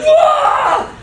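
very high, loud comic shriek; mock horrified scream. As soon as he sees the injury, hammed-up shriek. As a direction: shriek it for laughs. Screams on seeing the broken arm.

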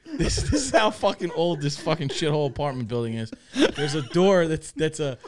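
normal talking voice

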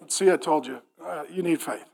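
very low, hushed reply; God. Acted. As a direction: murmur it deep and unruffled. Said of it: "And God's like".

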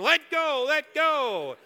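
loud, high mock yell; shouting at him to let go; screaming. Second church lady screaming let go. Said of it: "everybody's screaming at you".

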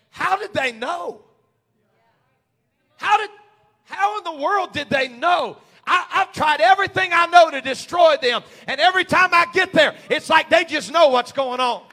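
loud, exasperated shouting; frustrated enemy. Acted. Preacher voicing the enemy who cannot destroy them, frustrated shouting. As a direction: shout it like a baffled, thwarted foe. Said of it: "the enemy to be so frustrated".

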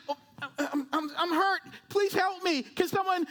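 high, hurt, halting begging; acted plea for help. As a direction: play someone injured pleading, hammed up. Acts injured person begging for help.

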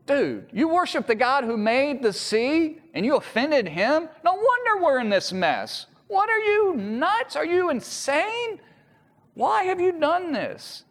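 a sailor who can't believe what he's hearing; incredulous sailors. Retold or imitated. Sailors react to Jonah's confession.

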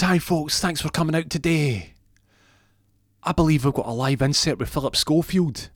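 high, bright showbiz host greeting; mock presenter welcoming the cameras. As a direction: greet the press like a breakfast-TV host. Addresses press as host.

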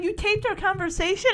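high, incredulous mock accusation; indignant surprise. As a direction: imitate a shocked demand. Mike confronts Chillings, retold.

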